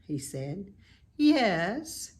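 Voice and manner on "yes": a light, sweet, singing lilt; lightly voiced spider, sweet and musical. A narrator's reading. Charlotte answers Wilbur in a light sweet lilt. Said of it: "in her sweet musical voice".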